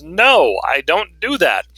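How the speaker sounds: a slow, firm, unapologetic no. Shown as a demonstration. Teacher modelling how to turn a client down, firm and slow. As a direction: say no and mean it, slowly. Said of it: "don't be afraid to use that magic word".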